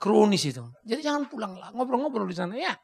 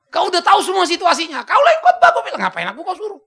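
laughing retort; 9 semitones higher, 10 dB louder than usual. Retold or imitated. His sarcastic reply to host.

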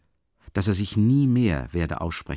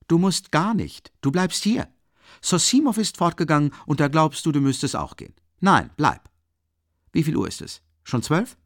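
high, loud, animated ordering; agitated insistence; 8 semitones higher, 5 dB louder than usual. A narrator's reading. Host refusing to let his friend leave, agitated insistence. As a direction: press on urgently, voice pitched up.

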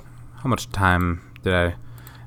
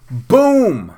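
loud, high exclamation; triumphant shout; 12 semitones higher, 6 dB louder than usual. Real, not acted. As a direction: cheer your own success, high and loud. Celebrates own impression, mock triumph.

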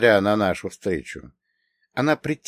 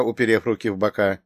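usual speaking voice

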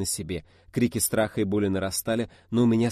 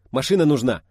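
loud, raised urgent call; shout; 4 semitones higher, 7 dB louder than usual. A narrator's reading. Kivrin tells them they need transport, shouting. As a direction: shout it to the whole group. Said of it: "крикнул Киврин".

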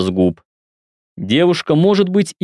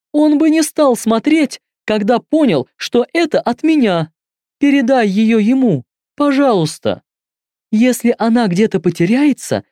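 put-on higher-pitched young woman, pleading; 11 semitones higher than usual. A narrator's reading. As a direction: voice her as a desperate girl. Same caller continues.

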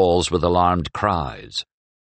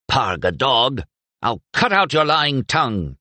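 raised, heated threat; angry threat; 6 semitones higher than usual. Acted. Young man threatens his rival mid-brawl, heated. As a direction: snarl the threat at him.

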